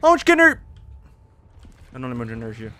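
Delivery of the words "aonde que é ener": high loud cry; frustrated yell. Real, not acted. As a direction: cry out in exasperation. Frustrated in game.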